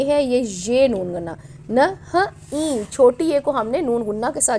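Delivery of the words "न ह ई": न, ह, ई very slowly, each apart, higher; sounded out. Shown as a demonstration. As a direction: stretch न ह ई as separate letter sounds. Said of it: "ये मैंने आपको पहले भी बहुत बार बताया". (न ह ई अलग-अलग, खींचकर)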